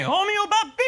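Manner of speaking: hint of a squealed title; mock title. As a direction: sing out the joke name up high. Naming his joke title.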